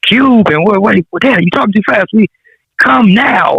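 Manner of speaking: put-on high-pitched, incredulous young caller; retold bewilderment. Son on the phone to his fast-talking dad, imitated bewilderment.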